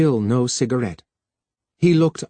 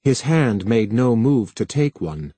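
plain reading voice

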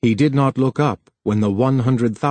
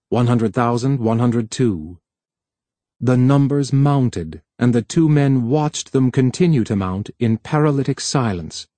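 ordinary delivery, nothing marked

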